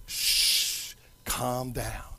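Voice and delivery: barely voiced, low soothing murmur; long shh, then settle it very softly; hushing. Jesus settling the sea, very soft low hush. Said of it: "he says to the sea".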